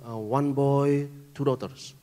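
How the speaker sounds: slow, deliberate foreign driver revealing a prophecy; taxi driver's words. Retold or imitated. Taxi driver tells the pastor his children, slow and knowing. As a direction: lay out each word slowly, like knowing a secret. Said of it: "Lalu dia bilang".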